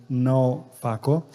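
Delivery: ordinary talk, nothing marked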